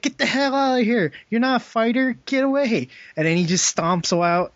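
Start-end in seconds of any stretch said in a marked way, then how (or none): 0.0-2.9 much higher, hammed-up scolding; mock angry Thor. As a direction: shoo him off like a pompous bully. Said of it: "he's like".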